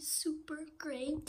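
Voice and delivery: secretive whispered aside; hushed aside to the listeners. Acted. List of treats whispered.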